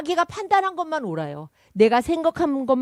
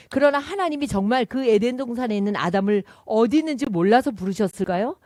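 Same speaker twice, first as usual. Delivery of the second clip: usual speaking voice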